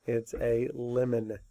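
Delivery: lemon with a short e, said slowly and softly; slow correct lemon. Shown as a demonstration. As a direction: model "lemon" slowly and gently. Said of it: "that's not how you say it".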